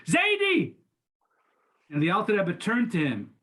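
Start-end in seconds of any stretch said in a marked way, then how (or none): 0.1-0.7 high, piercing shout of a name; sudden high shriek; child's shriek. A narrator's reading. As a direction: shriek it out, the boy springing from hiding. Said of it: "suddenly he shrieked".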